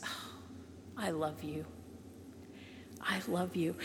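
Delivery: hushed, gentle voice of God; soft whisper. Acted. Preacher voicing God softly to the congregation, hushed whisper.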